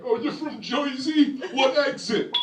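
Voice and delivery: high, slow, hammed-up Jersey accent; mock Jersey accent. Outsider teasing someone from Jersey, mock Jersey accent. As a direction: mimic someone making fun of the Jersey accent. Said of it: "make fun of your, our accent".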